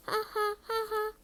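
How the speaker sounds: high taunting ha ha ha; mocking cackle. Peeves taunting Filch, high mocking cackle.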